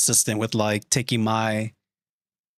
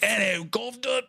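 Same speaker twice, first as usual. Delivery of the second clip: high mock English accent; badly faked English greeting in a raised voice; 10 semitones higher, 4 dB quieter, faster than usual. Streamer obliging a chat request, high bad English accent. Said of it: "Do a English accent".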